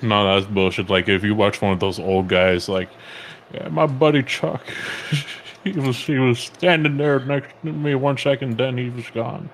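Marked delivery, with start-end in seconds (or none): 3.5-9.5 put-on higher, hoarse old veteran reminiscing; old man voice. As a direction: play a grizzled old soldier telling war stories. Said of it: "your old man voice is like a Bane voice".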